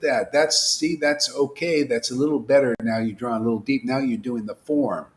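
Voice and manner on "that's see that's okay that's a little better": normal talking voice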